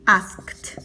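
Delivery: asked as one syllable, "askt"; final T sound. Shown as a demonstration. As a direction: end asked on a released T. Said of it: "se pronuncia con una T".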